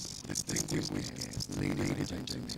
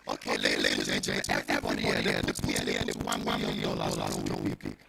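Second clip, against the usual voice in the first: usual speaking voice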